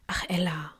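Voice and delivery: a weary father sighing her name in a whisper; sighed whisper. Acted. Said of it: "seufzte er nur".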